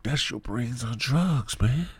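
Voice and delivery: mock PSA line. Parody of egg drug ad.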